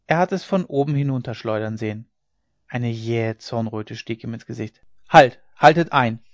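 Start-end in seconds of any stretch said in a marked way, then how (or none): 5.1-6.2 sudden very loud shout to stop; urgent, forceful order; shouted command. A narrator's reading. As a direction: shout down to halt the work. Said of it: "schrie er zu den Karren hinunter".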